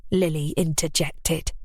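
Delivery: usual speaking voice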